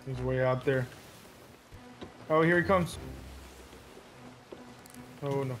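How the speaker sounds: ordinary talk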